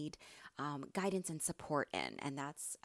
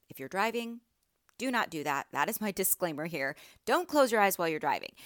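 loud, emphatic safety disclaimer; projected, insistent caution; firm warning; 7 dB louder, faster than usual. Real, not acted. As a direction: warn them firmly, raising your voice.

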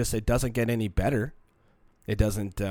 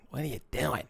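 goofy voice; 2 semitones higher, 5 dB quieter than usual. Pretend. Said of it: "try to be funny, just be like".

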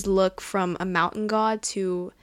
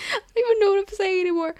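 high, rushed words through laughter; flustered laughing; 10 semitones higher, much faster than usual. Real, not acted. Podcaster losing her thread while gushing, flustered laughing.

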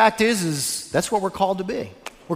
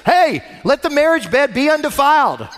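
put-on higher, louder playful call to the kids; groom's joking shout; 7 semitones higher, 4 dB louder than usual. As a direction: tease the kids on the bed with a mock-stern verse. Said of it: "and he says".

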